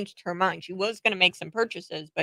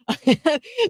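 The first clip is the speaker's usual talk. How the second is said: a quick amused laugh; short laugh; 9 semitones higher than usual. Real, not acted. Host laughing at the plushie story, short laugh. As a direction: laugh it off lightly.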